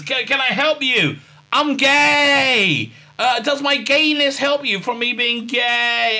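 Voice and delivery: high, hammed-up helper voice; drawn-out mock earnest offer, pitched high. Doctor offering Saru help, mock earnest.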